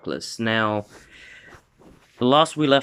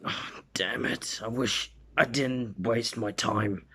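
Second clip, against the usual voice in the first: muttered frustration; 7 dB quieter than usual. Acted. A hero in the fan story regrets the wasted time, muttered frustration. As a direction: act out a soft, frustrated grumble.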